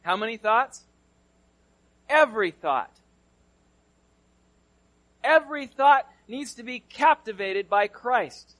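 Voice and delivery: loud, rising preacher emphasis; preaching at full force. Acted. Preacher to congregation, driving it home loudly. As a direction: drive the point home to the congregation.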